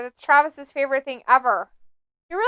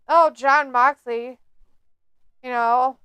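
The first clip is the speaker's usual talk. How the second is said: usual speaking voice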